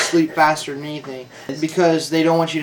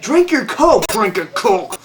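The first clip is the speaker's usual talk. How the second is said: patronizing; 5 semitones higher, slower than usual. Retold or imitated. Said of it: "patronized me".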